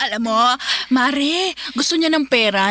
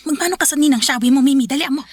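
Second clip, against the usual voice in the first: ordinary talk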